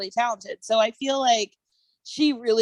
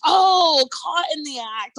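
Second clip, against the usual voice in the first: higher, drawn-out "oh" of getting the pun; retold sudden realization; 7 semitones higher, 5 dB louder, slower than usual. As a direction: act out the penny finally dropping. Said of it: "I was like".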